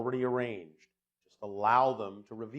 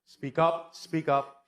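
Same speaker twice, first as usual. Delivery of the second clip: calling to speak up; 4 semitones higher, 5 dB louder, slower than usual. Real, not acted. Urging a quiet questioner louder.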